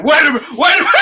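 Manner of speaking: high, loud frantic protest; mock panicked plea. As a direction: shout it like a doomed movie character. Acting out victim pleading before shot.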